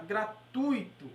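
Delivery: "Gratuito" very slow, gra-TUI-to, "tui" as one syllable; "Gratuito" drawn out slowly with TUI stressed as a diphthong; slow correct pronunciation. Shown as a demonstration. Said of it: "a sílaba tônica é tu".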